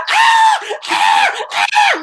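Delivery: high, loud, joyful screams; retold screams, pitched very high; screaming downhill. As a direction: let out wild whoops flying downhill. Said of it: "I remember I was screaming".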